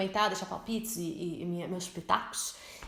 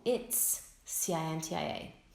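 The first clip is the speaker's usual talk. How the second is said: soft, slow It's with spelled letters; slow model answer; 4 semitones lower, 5 dB quieter, slower than usual. Shown as a demonstration. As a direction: model the reply gently.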